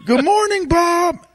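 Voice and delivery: very high, squawky parrot greeting. Retold or imitated. Telling friends about [name]'s parrot, very high squawk. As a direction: chirp it like a parrot saying good morning. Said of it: "he'd wake up every morning to".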